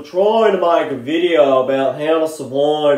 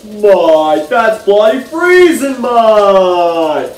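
loud Aussie complaint; outraged at the cold; 2 semitones higher, 7 dB louder, much slower than usual. Acted. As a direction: bellow it like thirteen degrees is arctic. Reacting to thirteen degrees.

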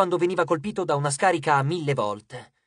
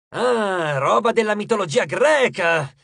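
louder, higher burst of relish; eager coach; 5 semitones higher, 5 dB louder, slower than usual. A narrator's reading. Coach hears of a Greek myth foe, excited. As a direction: pounce on the news with excitement.

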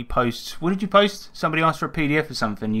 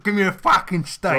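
blunt demand; 6 semitones higher than usual. Retold or imitated. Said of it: "Why don't you just say steak?".

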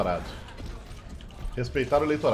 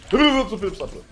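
loud, higher hype shout; excited cheer; 6 semitones higher, 6 dB louder than usual. Real, not acted. Streamer hyping his play, excited cheer.

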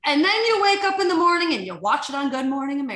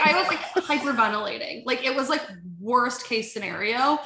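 usual speaking voice

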